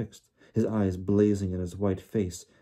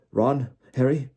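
higher, louder worried call; shaky voice; 5 semitones higher, 6 dB louder than usual. A narrator's reading. Father checking on the children, shakily. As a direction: call out anxiously, a touch shaken. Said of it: "His voice sounded shaky".

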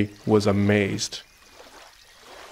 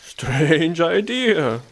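very slow, high, stretched words; puzzled sheep; 6 semitones higher, much slower than usual. A narrator's reading. Sheep hears hedgehog's odd wish, puzzled. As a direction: draw it right out in wonder.

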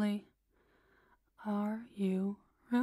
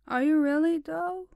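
high, loud teasing question; playful teasing; 6 semitones higher, 8 dB louder than usual. Real, not acted. Teasing her pet, loud and playful. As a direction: tease the pet with a bright rise.

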